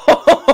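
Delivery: loud, high surprised laugh; a real laugh of disbelief; burst of laughter. Real, not acted. Laughs at the crushing move.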